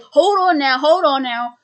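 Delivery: loud, high hold on now; mock panicked objection; alarmed protest. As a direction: stop them in a hurry, rattled. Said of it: "Jimmy's like".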